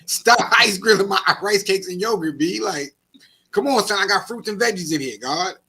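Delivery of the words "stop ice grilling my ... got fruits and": higher and louder, exasperated; indignant shopper yelling about his rice cakes; loud rant. Real, not acted. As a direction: vent loudly at the nosy shoppers. Ranting at nosy shoppers.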